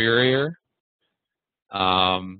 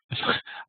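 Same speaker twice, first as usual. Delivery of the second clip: short laugh; 5 dB quieter than usual. Real, not acted. Laughs at blown line.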